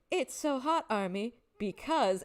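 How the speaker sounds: lightly mimicked stage call, starting high. Fan retelling a bandmate's teasing impression, lightly imitated. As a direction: imitate a performer's line to the crowd, drawing out the end. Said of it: "this perfect impression of [name]".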